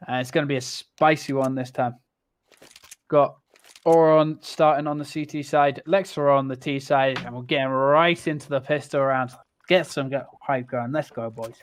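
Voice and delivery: esports caster. Acted. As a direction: build hype for the round. Caster opens the match.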